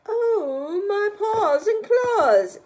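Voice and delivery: high, loud, slow panicky cry; frightened bear. Acted. Startled bear crying out at his reflection. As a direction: wail in fright. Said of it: "said the large and growly bear".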